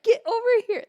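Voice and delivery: high, loud mock scolding; retold exasperated command; imitating mad brother. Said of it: "My brother was so mad".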